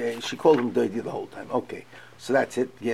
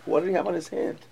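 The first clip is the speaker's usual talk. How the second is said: usual speaking voice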